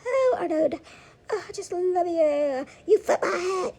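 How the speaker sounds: high, slow, giggly lovesick voice; hammed-up love confession; mock swooning. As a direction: coo it like a smitten fan alone at home. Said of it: "Talking about".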